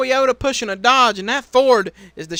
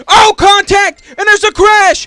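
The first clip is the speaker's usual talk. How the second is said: high, loud shouted play-by-play; excited crash call; 9 semitones higher, 10 dB louder than usual. Real, not acted. Race commentator to viewers as a crash breaks out, shouting excitedly. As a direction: yell it out as the cars collide.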